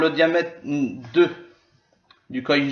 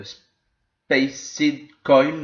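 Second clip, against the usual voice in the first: English accent; 3 dB louder, much slower than usual. Real, not acted. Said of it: "excusez-moi pour mon accent anglais".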